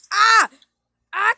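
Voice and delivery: loud sudden yelp; startled scream. Real, not acted. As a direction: cry out in surprise. Hit by enemy.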